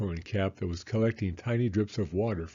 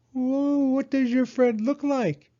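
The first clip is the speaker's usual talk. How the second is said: high, loud, keen owl; Owl's voice; 5 dB louder, slower than usual. Acted. Owl asking Hedgehog what Muddy looks like, high and keen. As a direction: ask eagerly as Owl.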